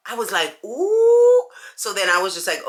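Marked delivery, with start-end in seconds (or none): 0.6-1.5 much higher, louder ooh; phew, got away; high relieved exclamation. Retold or imitated. Relief when sisters stay quiet.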